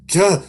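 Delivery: sharp, very high, loud cry of pain; a sudden stab in the eye; pained yelp. Acted. Narrator hit by sudden eye pain, sharp yelp.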